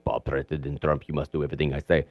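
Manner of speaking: a hammed-up Russian accent, purring orders to Trump; mock Russian accent. Putin whispering orders to Trump in mock Russian accent. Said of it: "It's a great Russian accent right there".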